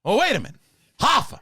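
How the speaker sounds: Pacino rant. Acted. Pacino rant bit continues.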